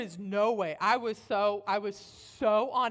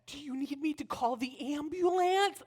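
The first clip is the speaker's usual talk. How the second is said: put-on higher, careful child's question; articulate little girl; 6 semitones higher than usual. Oldest girl offering to call ambulance, articulate child voice. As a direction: offer help in a precise kid voice. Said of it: "she's very articulate".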